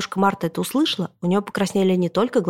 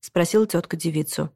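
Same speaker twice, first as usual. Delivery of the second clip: plain reading voice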